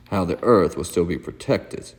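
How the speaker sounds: plain reading voice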